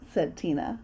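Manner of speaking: plain reading voice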